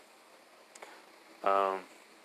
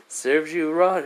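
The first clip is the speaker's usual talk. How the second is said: louder, higher mocking jab; smug gloat; 6 semitones higher, 6 dB louder, slower than usual. Belle in the tree gloating at the soaked Hermes, louder smug jab. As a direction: gloat down at him. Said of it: "he goes like".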